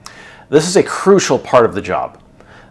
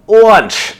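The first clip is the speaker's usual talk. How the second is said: excited reveal; 11 semitones higher, 7 dB louder than usual. Real, not acted. The big reveal of lunch.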